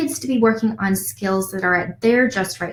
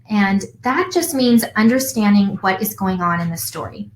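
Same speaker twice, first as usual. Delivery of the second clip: ordinary delivery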